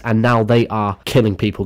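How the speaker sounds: usual speaking voice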